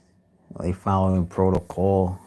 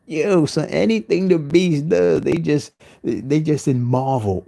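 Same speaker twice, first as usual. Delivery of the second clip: higher and louder, pushing the line; higher louder hype take; higher, louder rant; 5 semitones higher, 7 dB louder than usual. Acted.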